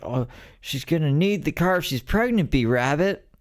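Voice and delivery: high put-on sidekick voice; Cheddar Bob impression. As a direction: pitch way up, playing the friend from the film. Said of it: "Cheddar Bob was like".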